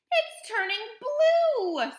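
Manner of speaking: high, loud panicked scream; a mother's shrill, alarmed scream. A narrator's reading. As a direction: scream it out, stretching the words. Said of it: "screamed Missus Beauregard".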